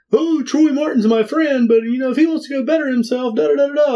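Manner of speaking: high-voiced send-up trailing into da-da filler; Cactus Jack. Podcaster ridiculing an out-of-character promo, mocking high impression. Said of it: "Like, totally out of Cactus Jack character".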